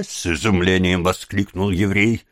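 plain reading voice

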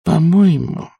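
high, measured opinion; unperturbed. A narrator's reading. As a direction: offer an opinion without any fluster. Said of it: "невозмутимо сказала она".

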